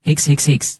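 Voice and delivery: high whimpering mock sobs; childish fake sobbing. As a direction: sniffle it out like a crybaby. Fake crying to avoid injection.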